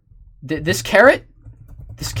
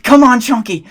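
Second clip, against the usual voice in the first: much higher, louder Come on Chonky; loud urging; 7 semitones higher, 8 dB louder than usual. Acted. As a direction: yell Come on Chonky to push him.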